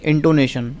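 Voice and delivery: usual speaking voice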